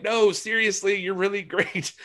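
high, eager gushing; earnest fan. Retold or imitated. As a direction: insist with fanboy eagerness. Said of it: "And I was like".

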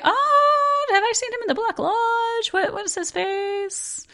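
high, puzzled, trailing off softly; puzzled surprise. Retold or imitated. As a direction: squint in confused recognition. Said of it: "watching this and going".

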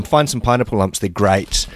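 ordinary talk, nothing marked

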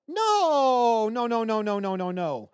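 high drawn-out no, tumbling downward; mock protest. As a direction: start high and wailing, then rattle down. Acted reaction to film scene.

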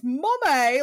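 imitated British-accented child's yell; high, loud mock scream for mother. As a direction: call out like a kid putting on British. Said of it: "Just, just screams".

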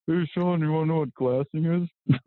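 high-pitched mock ribbing; mocking tease. Hunting partner needling a newcomer over glassing, mocking tease. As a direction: imitate a buddy teasing, pitched well up. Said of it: "he just laughed at me".

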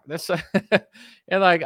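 ordinary talk

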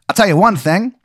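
put-on high-pitched old-time private eye voice; 1920s detective. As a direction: introduce yourself like an old movie detective. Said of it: "he talks like one too".

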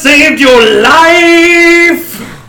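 high, very slow, loud protest; indignant shout. Acted. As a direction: yell it back, offended. Bard yells at angry townsfolk.

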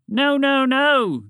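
high, loud panicked protest; shouting. A narrator's reading. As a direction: shout it urgently, pitch way up. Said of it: "the group shouted in unison".

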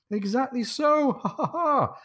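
high, drawn-out delight into ha-ha-ha; hearty acted laugh. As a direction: play a captain laughing in high-pitched glee. Jack replies with a laugh.

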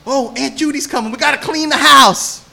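mother warning the family that Aunt Judy is on her way, hammed-up and high; imitating mom. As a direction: play the panicked mother before company. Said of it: "my mom used to go".

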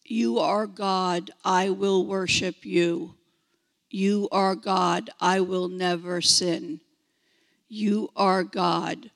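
low, slow robotic monotone; slow robot voice, evenly spaced words. Acted. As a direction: chant it like an obedient robot. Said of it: "to be like robots".

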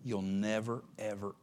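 usual speaking voice

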